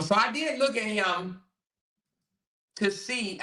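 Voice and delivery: normal talking voice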